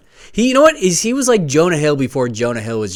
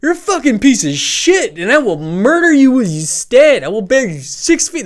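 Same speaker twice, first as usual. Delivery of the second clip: high, hammed-up thug threat; mock kid threatening the batter, raised pitch; mock modern kid trash talk; 9 semitones higher, 4 dB louder than usual. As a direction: threaten like a cartoonish tough kid. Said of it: "kids would just be like".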